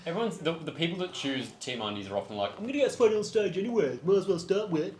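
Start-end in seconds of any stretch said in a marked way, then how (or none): 2.6-4.9 higher, mocking impression of them. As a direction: put on a silly mocking voice. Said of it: "That's how they all sound".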